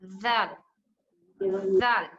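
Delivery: "जाल" with a lisped voiced "th" as in "this"; tongue between teeth. Shown as a demonstration. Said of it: "टंग को दोनों दीद के बीच में लेकर आएंगे".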